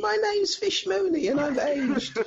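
high, soft, put-on London accent as Fish Mooney. Fish Mooney inviting them into her nightclub, London accent. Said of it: "doing a London accent".

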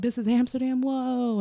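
high-pitched retold amazement; freaking out. Traveler freaking out on reaching Amsterdam, high amazed voice. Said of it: "freaking out".

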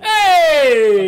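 loud high New York shout; tough New York hey. Pretend. Joining the New York snowman riff, loud shout. Dice Clay snowman bit.

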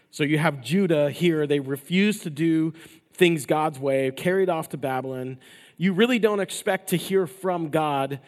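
forceful preaching. Acted. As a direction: hammer the point home to the church.

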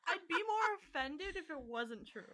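high, loud, through laughter; laughing admission. Real, not acted. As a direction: concede it while cracking up. Laughing at being called squirrel.

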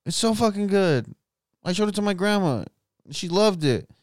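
high-pitched imitation of a gushing fan; hammed-up high voice of a fan; enthusiastic fan plug. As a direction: pitch it up, recommending the show to a friend. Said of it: "be like".

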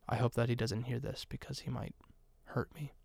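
trailing into a quiet, mock-nervous mumble; mock-fearful hush. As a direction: drop your voice as if he might overhear. Mock fear of fighter overhearing.

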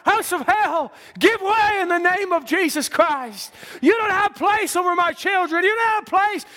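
high, forceful pulpit rebuke; shouted command. Real, not acted. Preacher ordering hell away from God's children, shouted command.

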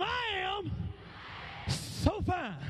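high, sassy call-out; with attitude. Shown as a demonstration. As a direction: lead the women in playful self-praise. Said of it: "Say it with some attitude".

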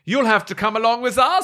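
hammed-up trooper demanding whose horse it is; German trooper. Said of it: "So I'll play the German troopers".

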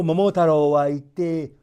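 usual speaking voice

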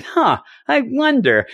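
slow, puzzled wondering; pondering Loki. Retold or imitated. Host retells Loki studying the hammer, pondering. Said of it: "He's like".

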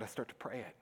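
ordinary delivery

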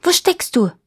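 high-pitched searching shout; officer calling out. A narrator's reading. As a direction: call out to someone hidden. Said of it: "und wieder ruft er".

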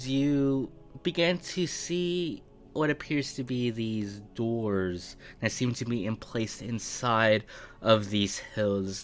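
ordinary talk

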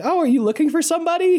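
put-on high, quick little-girl voice; squeaky child voice. Retelling the game, voicing the child on the train, high and quick. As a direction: pipe it up like a sweet little kid.

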